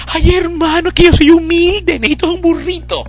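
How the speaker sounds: mock a falsely modest churchgoer; mock humble believer. Preacher mocking believer who wants only a donkey, whiny mock voice.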